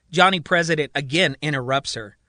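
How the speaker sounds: plain reading voice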